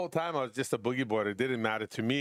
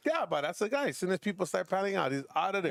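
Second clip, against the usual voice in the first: higher, lightly voiced gossip voice; retold gossip voice; 7 semitones higher than usual. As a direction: mimic the locals' excited chatter, pitched up. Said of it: "They go".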